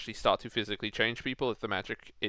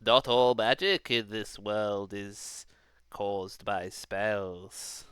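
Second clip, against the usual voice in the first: put-on slow, hushed old hermit; a lightly voiced sage hermit; wise old hermit voice; slower than usual. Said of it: "I gotta get my wise, my wise old, uh, hermit voice".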